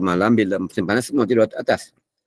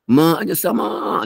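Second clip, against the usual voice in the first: retold shout; 10 semitones higher, slower than usual. Scholar on the roof shouting at the stars, high and slow. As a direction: stretch it out as a cry of awe. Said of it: "dia menjerit kuat".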